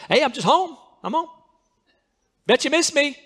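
cocky homecoming. Shown as a demonstration. Preacher mocks the wrong attitude of returning sinners, cocky and high. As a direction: act entitled, like they owe you. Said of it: "you don't come back to God".

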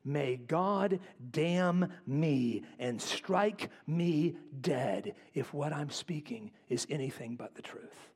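very slow, weighted words, fading to a hush; grave oath, sinking in volume; solemn self-curse. Acted. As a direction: invoke the curse word by word. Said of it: "Peter is basically saying".

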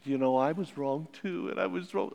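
forgiving wife. Retold or imitated. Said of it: "And she said".

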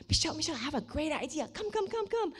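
high, quick, eager summons; bubbling over with a sudden idea; excited spontaneous friend. Retold or imitated. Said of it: "she's really funny. She's really, uh, spontaneous".

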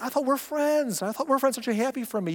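high, wounded "I thought we're friends"; hurt whine of a snubbed friend; hurt offended friend. Retold or imitated.

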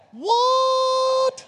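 high, loud falsetto squeal; mock surprised shriek. Pastor feigning shock at the crowd's answer, high squeal. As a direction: shriek it in playful disbelief.

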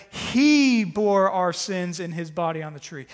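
He hit loud at the start; stress on He. Shown as a demonstration. Pastor reading the verse aloud, He stressed loudly. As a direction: open with a strong He. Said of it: "is emphatic".

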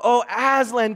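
high, loud exclamation; children crying out. A narrator's reading. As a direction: cry out the name in amazement. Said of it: "cried both the children".

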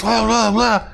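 playful mock babble; mock chatter. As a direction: rattle it off like background gabble. Mocking stewardess chatter.

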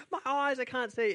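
high mock cry of pain; imitated panic. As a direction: replay his panic for laughs. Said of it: "And he's like".

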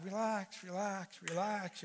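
soft, repeated calming words; soothing. Retold or imitated. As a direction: imitate someone calming a worried pastor. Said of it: "they're kinda telling the pastor".

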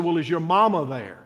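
usual speaking voice

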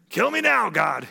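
high, loud melodramatic plea; hammed-up cry of despair to heaven; upset Jonah. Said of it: "he's upset".

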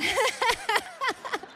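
high burst of giggling laughter; amused laugh. Real, not acted. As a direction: crack up at your own joke. Laughs at own joke about name.

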